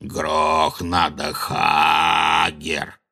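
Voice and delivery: low, very slow giant voice; ponderous, deep creature demand. A narrator's reading. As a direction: drag the words out like a lumbering giant.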